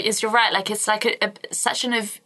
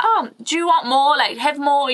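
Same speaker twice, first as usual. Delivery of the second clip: pushy host offering food; 6 semitones higher, 3 dB louder than usual. Retold or imitated. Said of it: "my family friends would be like".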